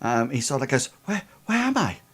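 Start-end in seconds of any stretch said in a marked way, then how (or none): 1.0-2.0 higher, hesitant and nervous; mildly acted nervous confusion. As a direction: falter as a lost boy coming round. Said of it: "he seems, um, incredibly, um, um, nervous".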